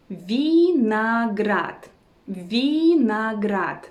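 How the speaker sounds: vinograd very slow, each syllable stretched. Shown as a demonstration. Teacher teaching the word for grapes, slow syllables. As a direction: draw out vinograd as vee, na, grad.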